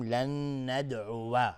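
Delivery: "لن ندعو" slow, ending in a clear "wa"; fatha on final waw. Shown as a demonstration. Teacher shows the voweled waw, "لن ندعو" ending -uwa. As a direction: finish "لن ندعو" on an open -uwa.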